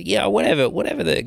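ordinary talk, nothing marked

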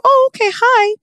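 high, bright-eyed awkward hi; mock cheerful greeting; awkward brush-off. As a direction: brush them off politely, up high. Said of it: "And I go".

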